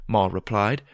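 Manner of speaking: ordinary delivery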